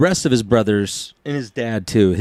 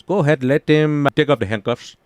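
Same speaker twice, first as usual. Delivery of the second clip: Doctor Rashans; 3 semitones higher than usual. Retold or imitated. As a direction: give orders as an accented doctor. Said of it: "and the doctor goes".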